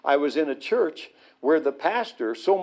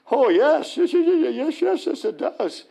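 overjoyed yes; 11 semitones higher than usual. Retold or imitated. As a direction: gush yes in joyful excitement. Rabbi overjoyed by the sign.

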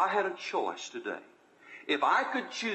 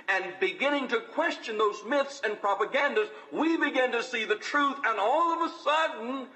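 preaching at full force; 9 semitones higher than usual. Acted. Pastor rousing his congregation with a preaching climax. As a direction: preach it higher and harder until the final cry.

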